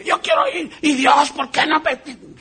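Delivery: high, strained mock whine to God; hammed-up whining, half shouted; whiny complaint. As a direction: whine like a frustrated believer. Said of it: "de que diga".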